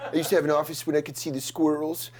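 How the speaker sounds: lightly voiced mumbling office loser; a hint of the sad cubicle guy from Office Space, half laughing; mild Office Space impression; Office Space mumbler. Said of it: "the guy's name in Office Space where he was like".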